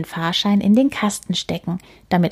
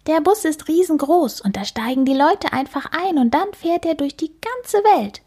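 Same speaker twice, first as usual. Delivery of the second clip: put-on a high, bright child's voice, full of wonder; a small girl proudly explaining the big world; eager little girl; 9 semitones higher than usual. Amelie explains bus rides to Antonio, high eager child's voice.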